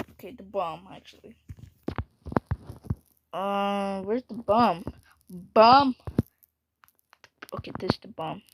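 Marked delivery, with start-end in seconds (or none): none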